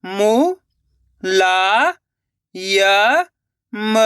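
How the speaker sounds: रु-का-व-ट spoken one slow drawn-out syllable at a time. Shown as a demonstration. Teacher sounding out a word for children, syllable by syllable. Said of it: "teacher sounding out syllables".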